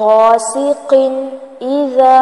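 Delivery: slow, drawn-out tajweed chant; Quran recitation. Acted. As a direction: recite each verse slowly with long held vowels.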